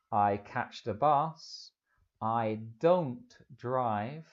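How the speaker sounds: very slow, spaced-out English; slow model answers. Shown as a demonstration. Teacher giving learners example answers, very slowly. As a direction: stretch each word out for learners.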